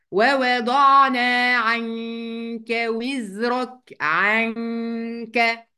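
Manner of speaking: very slow, drawn-out recitation; Quran recitation. Acted. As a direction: recite the verse slowly, nasalized. Recites the verse.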